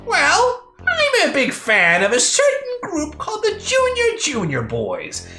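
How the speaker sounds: high, lilting reveal dropping at the end; coy villain. Acted. Villain revealing his favourite group, high coy voice. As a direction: confess it in a high singsong.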